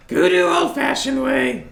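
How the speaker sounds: high, slow creature voice; put-on high-pitched voice in simple broken speech; Goo character voice. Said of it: "so he just kinda sighs and goes".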